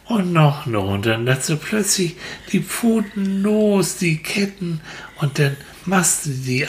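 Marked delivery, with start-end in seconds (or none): none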